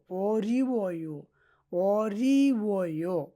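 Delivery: অরিওয় drawn out as "o-riii-wo-yo"; teacher stretching every vowel of অরিওয়; slow pronunciation demo. Shown as a demonstration. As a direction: sound out অরিওয় slowly, syllable by syllable. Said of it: "অয় হবে".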